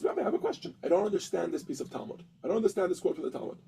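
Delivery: a hushed, innocent-sounding query; timid question. Acted. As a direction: ask softly as if puzzled, leading him on. Student feigns confusion to the rabbi.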